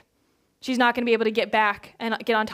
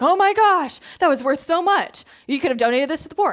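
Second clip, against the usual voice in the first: indignant gasp; 4 semitones higher, 3 dB louder than usual. Retold or imitated. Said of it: "they're like".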